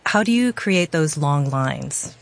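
ordinary talk

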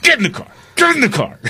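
high shrill-pitched mock order; imitating mom ordering son into car; mock furious mom. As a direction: bark it like a frantic mother. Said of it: "she flipped out".

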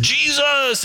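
high retold scream of the name; imitating a dream scream; mock scream. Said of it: "I'm screaming".

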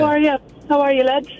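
light put-on Irish accent; Irish greeting, playing a second person. Caller as Sarah greets the hosts, light Irish accent. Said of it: "[name] does a great Irish accent".

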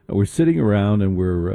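ordinary delivery

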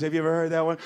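normal talking voice

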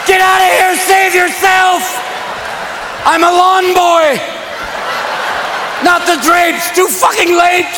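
high, strained mock-battle yells; hammed-up high-pitched emergency shouting; frantic hollering. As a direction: holler warnings in comic panic. Acts out frantic climax emergency.

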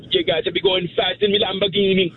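high-pitched mock Jamaican; put-on Jamaican lilt, pitched high; Jamaican accent. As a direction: perform it in a playful Jamaican accent. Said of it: "No, a Jamaican accent".

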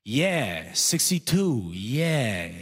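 put-on drawn-out, swooping old-man echo; ad-lib along cluelessly, stretching each word; senile older guy. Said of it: "the second older guy goes".

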